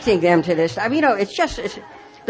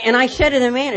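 normal talking voice

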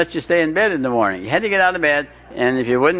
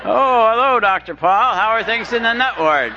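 mocking passerby; 8 semitones higher than usual. Mocking passersby greeting him.

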